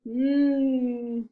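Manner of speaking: loud drawn-out thinking hum; long pondering hum. Acted. Elephant pondering whether to share, long loud hum. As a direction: hum while weighing the choice.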